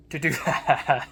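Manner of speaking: words dissolving into a loud laugh; breaking into laughter. Real, not acted. Doubting own talent as successor, breaking into real laughter. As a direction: crack up while finishing the phrase.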